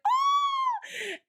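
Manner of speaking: high, loud delighted "Oh!"; excited cry. Retold or imitated. The restaurant lady spotting her old customer, delighted cry. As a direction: burst out in joy at seeing her.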